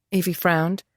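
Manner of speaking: ordinary delivery, nothing marked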